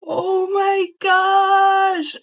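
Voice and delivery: high, very slow stretched squeal; high delighted squeal at a package; freaking out. Real, not acted. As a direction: let the joy spill out slowly. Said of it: "I'm freaking out".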